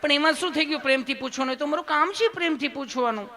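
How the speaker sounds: high, lilting put-on nurse voice; sweet, loving nurse. Nurse saying kindness is her job, high and sweet. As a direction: explain lovingly, pitched high. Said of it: "તમે કેટલું પ્રેમથી પૂછો છો".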